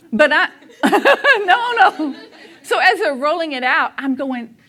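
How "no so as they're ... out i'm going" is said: ordinary talk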